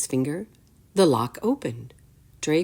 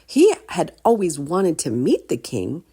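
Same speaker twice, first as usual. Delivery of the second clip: ordinary delivery, nothing marked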